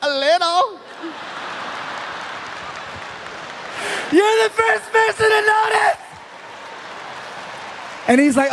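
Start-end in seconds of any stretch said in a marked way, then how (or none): 0.0-6.0 high, squealed mock coyness; drunk and flattered. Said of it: "I was so drunk, I said".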